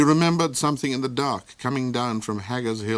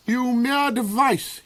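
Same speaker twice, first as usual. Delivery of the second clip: slow, high growling insult; gnarl; 14 semitones higher, 3 dB louder, slower than usual. A narrator's reading. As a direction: grind the insult out. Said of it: "he gnarled".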